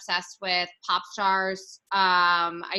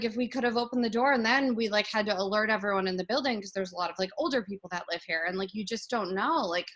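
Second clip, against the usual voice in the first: ordinary delivery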